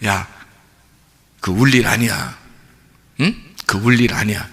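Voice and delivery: a hint of Jesus soothing a mother; gently voiced Jesus. Jesus telling the grieving widow not to cry, low and soft.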